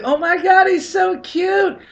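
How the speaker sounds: squealing high and loud, the last words stretched slow; gushing girlfriend. Retold or imitated. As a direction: imitate her melting over a cute dog. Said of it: "she's like".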